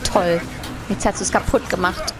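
normal talking voice

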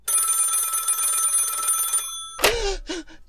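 frightened invalid jolted by a noise; startled gasp. Acted. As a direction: catch your breath in shock. Sudden fright in dark room.

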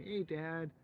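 put-on high squeaky child saying Hey, Dad; mock a little kid greeting Santa; child's greeting. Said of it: "and they say".

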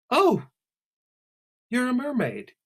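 high, loud delighted gasp; sudden surprise. Acted. Alice suddenly notices Celia's tail, surprised. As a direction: blurt out the discovery.

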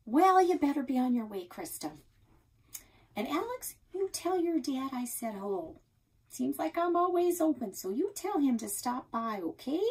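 a hint of a gossipy diner owner; old Nan. A narrator's reading. Nan sends kids off.

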